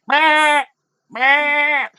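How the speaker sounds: very high, loud screaming bleat; goat scream. Acted. Game master as the goat starting to scream, shrieking bleat. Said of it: "like, a goat scream".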